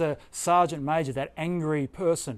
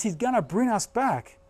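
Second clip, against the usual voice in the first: ordinary delivery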